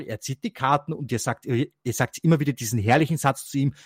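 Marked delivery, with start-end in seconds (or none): none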